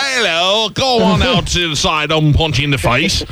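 high, hammed-up English accent; mocking English accent, drawn out and high. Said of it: "that is a full-on accent".

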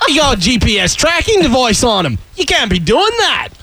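put-on high, indignant accent drifting Scouse; Aussie impression gone Liverpudlian; mock Australian slipping into Liverpool. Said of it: "That, that was Beatles".

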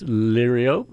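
liriope said as LEER-ee-ope; variant pronunciation. Shown as a demonstration. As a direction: say liriope slowly, ending in ope, no final ee. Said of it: "so many ways to pronounce".

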